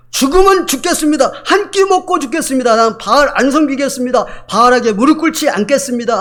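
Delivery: high, loud vow; resolute vow. Acted. Zarephath widow vowing not to serve Baal, loud and high. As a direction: declare your resolve with everything.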